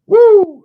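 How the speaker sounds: high, very loud "woo"; loud whoop. Real, not acted. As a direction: let out a piercing whoop. Whoops after counting drinks left.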